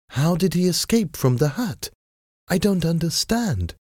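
high-pitched, bewildered lady; puzzled lady. A narrator's reading. Wife asking how her brother escaped, high and puzzled.

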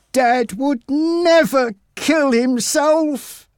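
high, slow, insistent denial; a boy refusing to believe it; stubborn insistence. Acted. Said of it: "Jack repeated".

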